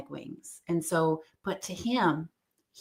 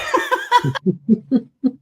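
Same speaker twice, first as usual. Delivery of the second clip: light laugh; 8 semitones higher, 7 dB louder than usual. Real, not acted. Laughs at own story.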